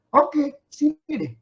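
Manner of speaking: Jesus inviting Peter, offhand. Pretend. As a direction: shrug and wave him over.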